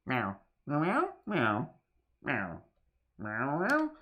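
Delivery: playful cat meows. Pretend. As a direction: meow like a cat.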